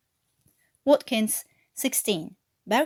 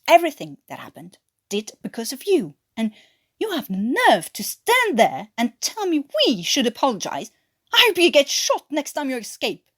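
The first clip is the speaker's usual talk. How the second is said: louder and higher, building to a threat; angry accusation; 5 semitones higher, 6 dB louder than usual. Acted. Watkins to Newkirk, loud bitter accusation. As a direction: let the grudge boil over.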